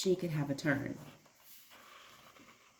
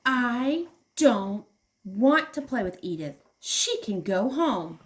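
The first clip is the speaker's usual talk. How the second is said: sulky tantrum; 4 semitones higher, 5 dB louder than usual. Acted. Tantrum about friend.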